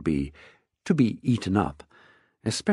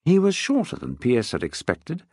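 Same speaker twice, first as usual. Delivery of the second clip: plain reading voice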